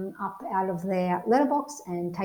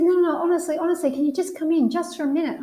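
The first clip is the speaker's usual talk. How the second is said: pleading insistence; 8 semitones higher, 3 dB louder, faster than usual. Retold or imitated. Husband insists she come in.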